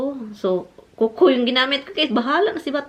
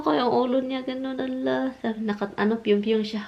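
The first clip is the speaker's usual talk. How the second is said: usual speaking voice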